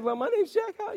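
high, put-on flirty introduction; hammed-up chat-up voice; mock pickup line. Jokes about a pickup line.

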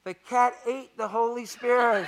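small put-on boy voice; boy's voice from rafters. Hired boy to the evangelist, small voice from rafters. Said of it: "he heard a voice say".

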